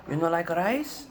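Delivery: put-on loud, high hurt Filipino-accented why; grandma offended you won't eat; upset grandma, Filipino accent. Upset grandma scolding the refusing grandson, Filipino accent. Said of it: "grandma offended".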